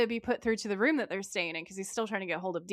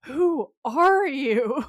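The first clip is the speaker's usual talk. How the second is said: very slow, louder mock disbelief; mildly disgusted bafflement; 3 semitones higher, 7 dB louder, much slower than usual. Sam facing Gary's reflection, drawn-out disgusted bafflement. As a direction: drag out each word, baffled and put off. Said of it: "mildly disgusted by the whole situation".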